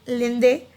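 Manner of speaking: लिंदे with a French nasal, rising at the end; French pronunciation. Shown as a demonstration. Teacher modelling French for the class. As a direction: say लिंदे the French way, linked to the vowel. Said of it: "India में हम इसको क्या बोलते हैं French में".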